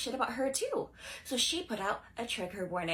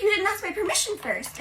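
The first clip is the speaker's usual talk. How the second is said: put-on indignant girl; 8 semitones higher, 4 dB louder than usual. Girl scolds crush over kiss.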